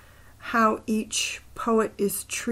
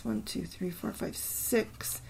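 softer half-whispered syllable count; counting under breath; 9 dB quieter, slower than usual. Real, not acted. Counting a poem's syllables to himself, half-whispered. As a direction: mutter the count to yourself.

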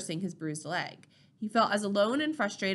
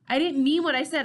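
higher, louder pleading protest; desperate protest; 6 semitones higher, 8 dB louder than usual. A narrator's reading. Teen protests being sent home, desperate. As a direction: burst out in your own defence.

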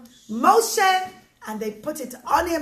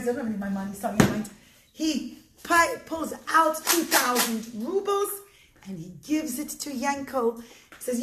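ordinary delivery, nothing marked

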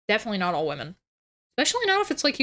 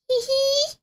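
high giggle. Shown as a demonstration. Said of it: "When I type hee hee".

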